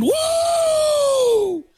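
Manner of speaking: WOO held long and high; drawn-out whoop. Pretend. Host announcing the pitching probables, playful whoop.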